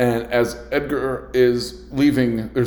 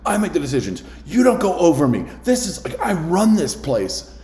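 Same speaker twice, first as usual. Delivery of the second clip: retold manager screaming at a coworker; angry manager scream; 8 semitones higher than usual. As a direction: scream it like a tyrant manager asserting control. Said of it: "started screaming at him".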